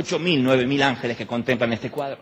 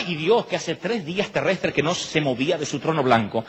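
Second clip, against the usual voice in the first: ordinary talk, nothing marked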